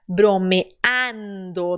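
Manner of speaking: very slow "Bromeando", wide open a; open-mouthed a. Shown as a demonstration. Teacher shows the open Spanish a. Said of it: "abra a boca".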